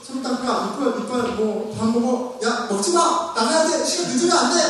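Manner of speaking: high, rising string of orders; mock nagging. Parent rushing children out the door, mock nagging. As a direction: play a harried parent hurrying the kids.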